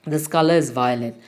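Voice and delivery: ordinary talk, nothing marked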